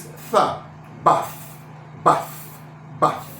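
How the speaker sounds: BATH ending in a soft th, not T; unvoiced th. Shown as a demonstration. Teacher drilling T H, BATH with unvoiced th. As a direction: breathe out the th in BATH. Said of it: "this T H is not T".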